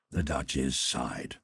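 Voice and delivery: ordinary talk, nothing marked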